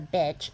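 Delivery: WITCH mispronounced as bitch, with a B; mother's pronunciation. Retold or imitated. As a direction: say bitch as a child's mistaken witch. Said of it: "only heard my mom's pronunciation of it".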